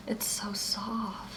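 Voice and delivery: slow soft whisper. Real, not acted. Stroking fur, hushed.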